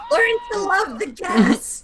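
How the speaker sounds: slow, laughing quote; Family Guy quote. Retold or imitated. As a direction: quote the cartoon, slowly. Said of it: "Family Guy thing".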